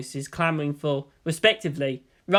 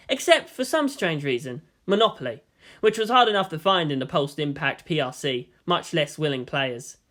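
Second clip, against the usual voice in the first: usual speaking voice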